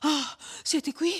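slow, high, winded gasp of a question; out of breath. A narrator's reading. Agnese arriving winded at the young couple, out of breath. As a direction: get the words out with effort. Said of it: "cavando fuori la parola a stento".

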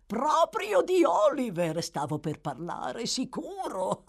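put-on high, excited old schemer with laugh. A narrator's reading.

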